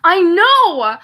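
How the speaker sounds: loud, high burst of agreement; excited outburst. Real, not acted. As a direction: blurt it out fired up. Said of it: "that pissed me off so bad".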